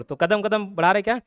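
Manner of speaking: usual speaking voice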